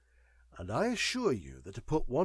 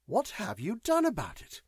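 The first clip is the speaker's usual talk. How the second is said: altered tone; 9 semitones higher than usual. A narrator's reading. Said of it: "asked March in an altered accent".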